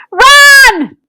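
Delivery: high loud urgent yell; shouted warning. A narrator's reading. Muggle Wump to the children: loud high shout. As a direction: scream it to save them. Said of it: "Muggle Wump shouted".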